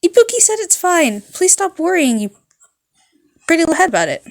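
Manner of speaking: put-on high, loud bubbly girl voice; high loud cheerful thanks; chipper rock girl. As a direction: toss it off brightly like a rock star. Ibuki reassuring nurse.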